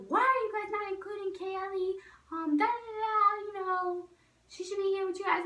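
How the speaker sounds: high-pitched mock scolding; yelling mom. Cousin's mom scolding kids for excluding Kaylee, high mock yell. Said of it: "she starts yelling at us".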